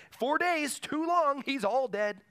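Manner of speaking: exasperated, high-pitched objection; acted hopeless protest. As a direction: voice the doubters, pitched right up. Voicing doubters who don't get it.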